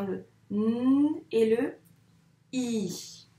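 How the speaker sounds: teacher isolating "n" and "i"; sounds isolated. Shown as a demonstration. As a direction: hold "n" as a long hum, then "i" apart. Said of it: "qu'est-ce qu'on entend dans la syllabe ni".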